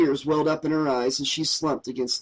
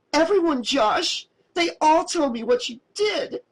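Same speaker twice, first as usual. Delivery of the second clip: crying out; 13 semitones higher than usual. A narrator's reading. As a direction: fling it back at him. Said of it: "she cried".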